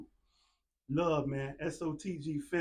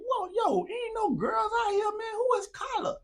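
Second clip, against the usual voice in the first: put-on much higher, louder, bewildered boyish voice; baffled kids; 4 dB louder than usual. Friends in the yard asking who Carla is, baffled. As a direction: act like the kids can't believe it. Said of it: "I'm telling they like".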